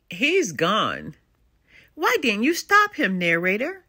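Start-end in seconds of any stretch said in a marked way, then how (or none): none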